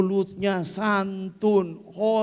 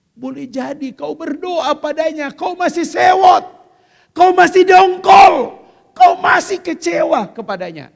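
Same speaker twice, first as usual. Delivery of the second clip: builds to a loud high shout on sewot and dongkol; rising shout; 8 semitones higher, 15 dB louder than usual. Acted. Preacher shouting at mothers who pray while still resentful. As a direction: hammer each resentment louder. Preacher rebuking anger in prayer.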